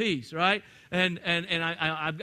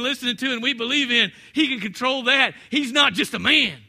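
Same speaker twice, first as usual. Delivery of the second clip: normal talking voice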